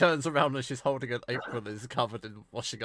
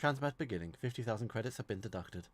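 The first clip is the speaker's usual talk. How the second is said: computer voice; 12 dB quieter than usual. Acted. AI computer announcing transport.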